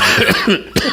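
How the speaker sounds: coughing to get the voice back; real coughing fit; struggling cough. Real, not acted. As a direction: cough hard at a failing voice. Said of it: "I'm having a hard time".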